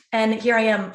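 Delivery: ordinary delivery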